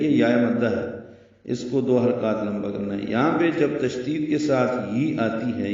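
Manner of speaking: ordinary talk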